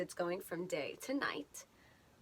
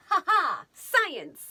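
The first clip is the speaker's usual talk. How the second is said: triumphant; 8 semitones higher, 10 dB louder, slower than usual. Real, not acted. Triumphant exclamation.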